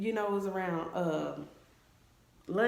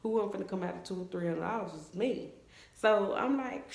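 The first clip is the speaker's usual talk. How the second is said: ordinary talk, nothing marked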